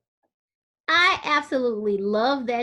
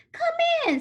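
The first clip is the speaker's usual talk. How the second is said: sung-out high come in; Ma welcoming. Acted. Ma greeting visitors at the door, high sing-song welcome. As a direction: call it out brightly, much higher.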